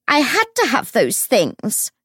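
high, desperate wail; broken child's wail. A narrator's reading. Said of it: "she wailed, in a voice like a broken child's".

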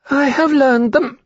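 very high-pitched creature voice; little hatchling. Acted. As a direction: claim it in a tiny high dragon voice. Hatchling's voice in the story.

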